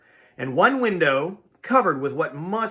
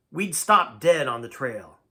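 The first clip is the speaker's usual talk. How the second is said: usual speaking voice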